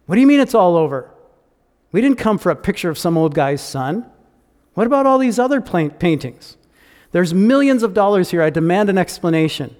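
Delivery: loud, fast outraged demand; furious bidder demanding answers; angry protest. Acted. Bidders angrily protest the auction ending.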